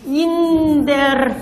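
internet said very slowly, Russian-style hard sounds. Shown as a demonstration. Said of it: "internet怎么读出来的".

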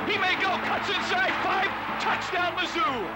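rising excited play-by-play; touchdown call. Real, not acted. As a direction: build up to the touchdown shout. Radio call of a touchdown run.

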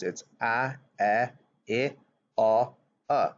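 short vowel sounds a, e, i, o, u, each clipped and clear. Shown as a demonstration. Teacher modelling the short vowel sounds for young learners. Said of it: "when we say the sound of the letters".

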